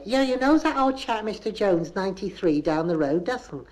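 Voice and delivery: high-pitched, lilting Bristolian lady; Bristol gossip voice. Shown as a demonstration. One lady tells another about Mister Jones, high gossipy demonstration. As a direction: gossip with a rising tag. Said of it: "that's the kind of voice I shall use".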